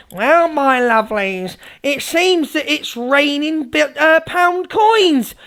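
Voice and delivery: slow, drawn-out mocking announcement; mock streamer impression. Mimicking a streamer telling tall tales to followers, slow mockery. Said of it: "I'll do an impression of the Evil Army".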